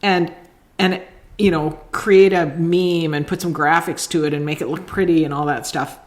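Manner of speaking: usual speaking voice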